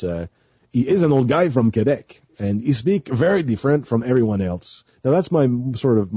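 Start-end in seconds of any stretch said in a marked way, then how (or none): 0.7-4.8 put-on old guy from Quebec in a modern French-Canadian accent; contemporary Quebec French accent. Shown as a demonstration. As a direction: speak with a lilting contemporary Québécois accent. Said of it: "my contemporary accent".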